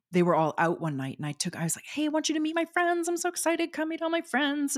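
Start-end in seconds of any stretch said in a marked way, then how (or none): 1.9-4.8 high-pitched mock excitement; excited invitation. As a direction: act out eagerly rounding someone up in a high voice. Retelling her eager invitation to meet friends.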